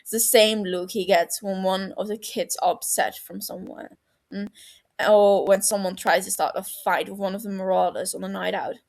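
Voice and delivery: ordinary delivery, nothing marked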